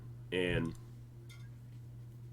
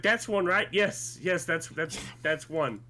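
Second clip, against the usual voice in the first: excited retold exchange; 7 semitones higher, slower than usual. Retold parents and excited child.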